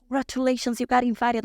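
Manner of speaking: high, excited retold congratulations. Friends telling her she got invited, imitated excitement. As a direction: imitate friends breaking good news.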